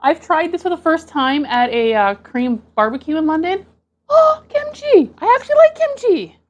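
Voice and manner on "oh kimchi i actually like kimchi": much higher, loud delighted squeal; thrilled. Real, not acted. Recognises kimchi delighted.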